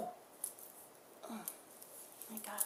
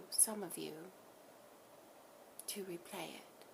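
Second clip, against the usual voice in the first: channeled message; 2 semitones higher, 6 dB quieter than usual. Acted. Collective speaking through her to the listener, soft and hushed.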